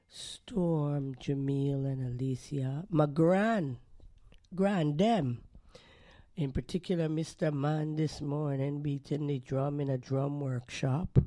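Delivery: ordinary talk, nothing marked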